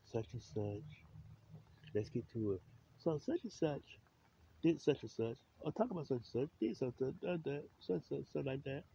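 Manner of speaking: mumbled mimicry, hushed start turning high and thin; mock a mumbler with no bass in the voice; mumbling radio hosts. Mocking how some people talk on a radio show, high mumble. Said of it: "they be sounding like this".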